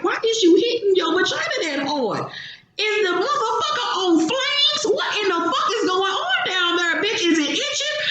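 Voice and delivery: high, squealing outrage with laughter; shocked disbelief. Retold or imitated. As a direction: shriek at the screen in disbelief. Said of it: "And I was like".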